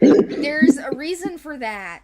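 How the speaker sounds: teasing hint. Pretend. Hinting at a secret to listeners, slow and coy. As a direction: drop a mysterious hint.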